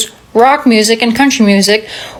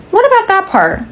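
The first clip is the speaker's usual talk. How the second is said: "What about that part?" pitched much higher, disbelieving; incredulous; 9 semitones higher than usual. Shown as a demonstration. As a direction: ask it again in mock disbelief, way up high. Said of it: "repeated line, second take marked".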